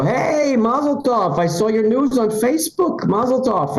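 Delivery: high, put-on excited greeting; mock effusive congratulations. Somebody in the lobby congratulates, mock effusive. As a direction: gush congratulations in a high neighbour voice.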